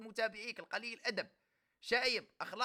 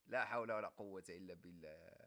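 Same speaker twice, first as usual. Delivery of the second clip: mock deep sigh; 6 dB quieter than usual. Mocking exasperation at insults.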